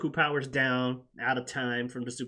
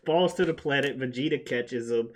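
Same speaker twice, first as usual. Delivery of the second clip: ordinary talk, nothing marked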